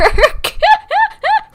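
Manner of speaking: loud, high cackle of a laugh; high burst of laughter. Real, not acted. Laughs about calling him.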